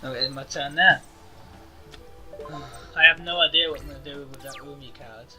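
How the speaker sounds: usual speaking voice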